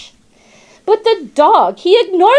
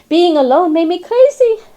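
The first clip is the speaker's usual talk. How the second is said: ordinary delivery, nothing marked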